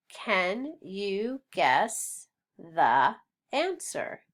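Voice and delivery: slow, word-by-word question; slow choral-reading lead; read-along pace. Shown as a demonstration. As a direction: space out each word for learners to follow. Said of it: "let's read it".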